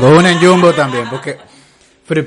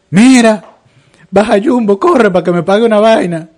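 higher, louder urgent call, much higher; urgent plea; 8 semitones higher, 5 dB louder than usual. Retold or imitated. He begs Annie Lady to rush to Jumbo, urgent loud plea. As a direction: hurry her on the phone.

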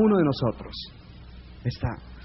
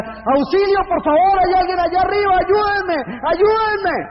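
desesperado, mucho más agudo, gritando por ayuda; gritos de auxilio; 3 dB louder than usual. Acted. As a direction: grita como quien cuelga del precipicio. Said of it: "comienza a gritar". (el hombre colgado pide auxilio, grito agudo desesperado)

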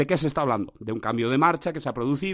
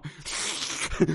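unvoiced slurping mouth noise; mollusc-like suction; 5 dB quieter than usual. Shown as a demonstration. Podcaster demonstrates his failed tatami imitation, slurpy suction noise. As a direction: suck air in like a mollusc. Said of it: "me ha salido una succión como de molusco".

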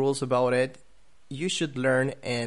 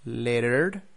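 "Lettered" said as American "letter", T tapped, final R sounded, rising; American demo of "Lettered" as letter, tune left open; American accent. Shown as a demonstration. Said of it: "T's in the middle of words sound like R".

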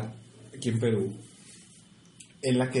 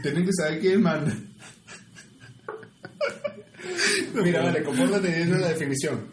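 laughing; 4 semitones higher than usual. Real, not acted. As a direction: laugh while bossing him around. Teasing partner, laughing. (burlón, entre risas)

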